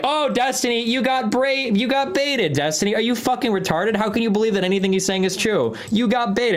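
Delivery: high, whiny mock of gloating chat; mocking chatter voice. Streamer mocks his chat jeering that he got baited, in a high whiny mock voice. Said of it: "you guys are like".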